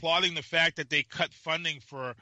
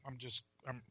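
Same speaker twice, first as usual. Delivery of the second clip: low, much softer, pissed mutter; muttered anger; 5 semitones lower, 13 dB quieter than usual. Real, not acted. Host grumbling about skinny jeans, quiet muttered anger. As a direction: grumble it under the breath, fed up. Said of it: "I'm pissed".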